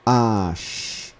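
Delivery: "A, X" joined as a-sh; blending sounds. Shown as a demonstration. Teacher blends syllables for students. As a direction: blend "A, X" into one word. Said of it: "vou juntando os sons".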